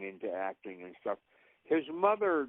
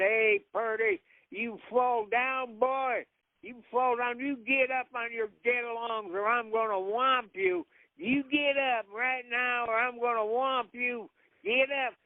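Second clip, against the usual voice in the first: pleading with dog; 13 semitones higher than usual. Acted. Acted scene with dog.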